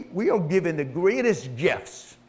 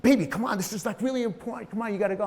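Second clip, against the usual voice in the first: higher-pitched coaxing, imitating a wife; pleading wife; 6 semitones higher, faster than usual. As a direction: urge a reluctant husband out of bed. Wife pleading with him to come.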